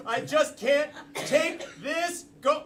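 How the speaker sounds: high, hammed-up tearful outburst; overwrought breakdown. Gabby breaking down to her rival on the golf course, overwrought. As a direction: build to a mock-desperate, high-pitched plea. Acted emotional breakdown in improv.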